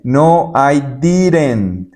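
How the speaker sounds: ordinary talk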